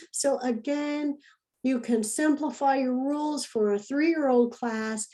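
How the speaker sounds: normal talking voice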